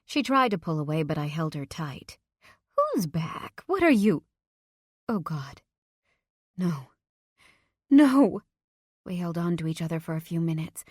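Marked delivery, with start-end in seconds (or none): 2.8-4.2 rising, louder, frightened; panicked. Acted. Mother panicking at the news. 5.1-8.4 anguished cry. Acted. Mother breaking down, both crying.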